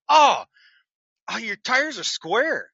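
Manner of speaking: high, loud startled cry that slows down; alarmed shock. Retold or imitated. Driver reporting smoking trailer tires, startled and loud. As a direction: blurt it out as the shock hits.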